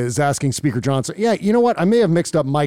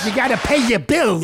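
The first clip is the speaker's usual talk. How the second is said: mock Trump echo; 7 semitones higher than usual. Host echoing Trump's line from the clip, high mock shout. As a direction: parrot it back with a sneer.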